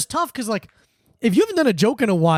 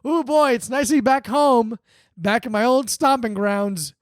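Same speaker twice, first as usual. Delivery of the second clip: slow, contented sigh of being home; mock homecoming; 3 semitones higher, slower than usual. Comic telling a cab driver he's home, slow and pleased. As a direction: play the cheerful local returning. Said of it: "get in the cab and go".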